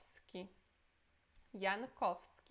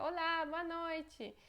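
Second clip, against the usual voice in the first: greeting tone; 6 semitones higher than usual. Shown as a demonstration. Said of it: "Seria olá".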